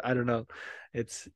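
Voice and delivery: normal talking voice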